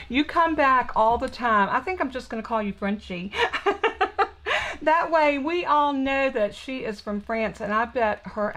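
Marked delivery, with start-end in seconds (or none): 3.4-4.8 high, bubbly burst of laughter; giggle. Real, not acted. Crafter amused at nicknaming a returning viewer Frenchie, giggling. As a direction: laugh at your own little joke.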